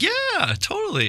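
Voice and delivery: put-on high, airy girlfriend voice; imitating his wife. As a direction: play her, light and eager. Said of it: "And she's like".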